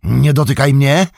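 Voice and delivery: wyżej i głośniej, gniewny krzyk; okrzyk gniewu. A narrator's reading. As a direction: odepchnij go krzykiem. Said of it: "krzyknął". (Frodo krzyczy na Sama, by go nie dotykał, gniewnie)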